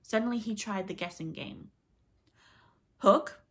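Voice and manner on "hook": loud, high hail; calling out. A narrator's reading. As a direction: hail someone unseen. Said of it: "he called".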